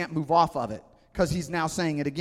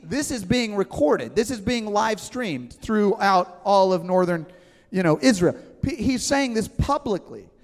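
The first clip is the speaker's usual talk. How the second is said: normal talking voice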